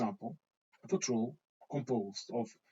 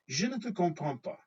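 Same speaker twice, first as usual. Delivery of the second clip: higher, dismissive mock French rebuff; snooty French retort; 5 semitones higher than usual. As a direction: play the haughty local brushing off a foreigner. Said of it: "you will have heard that a lot from the French".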